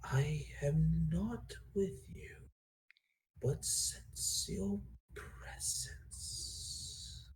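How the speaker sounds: slow, soft whisper of a distant dragon; dragon's voice in his head. Acted. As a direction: breathe it out slow, like a voice from far away. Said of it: "you hear the voice of the dragon that was speaking to you".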